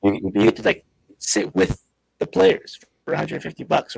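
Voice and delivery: choppy robotic mic sound; like a robot filter on the line. Real, not acted. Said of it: "You still have that robot voice".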